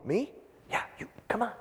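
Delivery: whispered, surprised "me?" then the magician's hushed "yeah, you"; a child's stunned whisper answered in a whisper; hushed back-and-forth. Retold or imitated. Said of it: "It's like".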